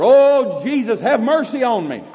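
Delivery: high, loud pleading cry. Acted. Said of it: "he cried out the louder".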